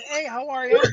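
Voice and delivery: his own upbeat hello in reply; friendly greeting. Retold or imitated. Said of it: "I go like this".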